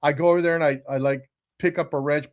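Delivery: normal talking voice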